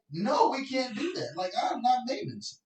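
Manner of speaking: high-pitched, exasperated pushback; exasperated retort. Retold or imitated. Crew member refusing the assistant's lighting request, exasperated. Retold argument with demanding assistant.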